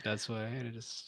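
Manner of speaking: normal talking voice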